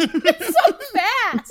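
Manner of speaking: high, slow, laughing disbelief. Real, not acted.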